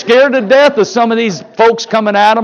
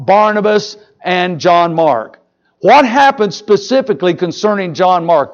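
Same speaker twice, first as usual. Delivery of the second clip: usual speaking voice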